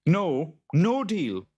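high, forceful character "no"; firm refusal. A narrator's reading. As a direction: refuse the deal emphatically, pitch jumping up. Jude refuses the boggart's terms.

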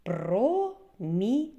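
"Промінець" broken into Про, мі, нець with pauses; slow, separated syllables in "Промінець". Shown as a demonstration. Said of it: "Ще раз слухайте".

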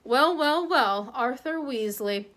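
slow, drawn-out contempt; sneering greeting. Acted. Aristocrat sneers at his rival in the shop, slow and contemptuous. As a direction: savour the insult slowly.

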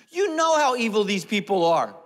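loud, high wail of blame; mock indignant shout. Aaron blaming the people to Moses, mock indignant shout. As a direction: bellow the excuse, hammed-up.

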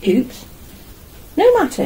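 ordinary talk, nothing marked

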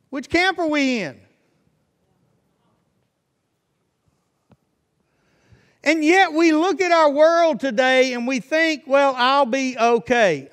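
high, loud pulpit exhortation; fervent preaching. Real, not acted. Preacher warning his congregation about the devil, fervent preaching.

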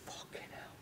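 voiceless, stunned swearing; re-enacted hushed amazement; awed whisper. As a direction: whisper it in disbelief. Awed reaction to the Ziggy sound.

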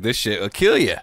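wry joking voice; dark joke. Retold or imitated. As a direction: crack it as gallows humor. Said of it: "he makes a joke like".

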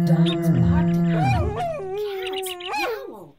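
loud, booming reading of the animal noises; loud storytelling. A narrator's reading. Narrator telling children of the animals' din, loud. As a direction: belt out the animal racket.

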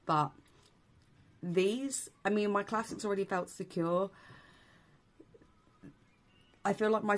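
over snap-on false teeth; speaking with veneers in. Real, not acted. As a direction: through clip-on dental veneers. Seen 2: Bright uniform veneers on teeth while speaking; inserted just before.

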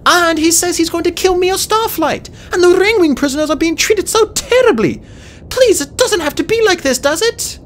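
put-on high, desperate girl dragon; pleading. A narrator's reading. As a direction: beg urgently. Fate Speaker keeps pleading.

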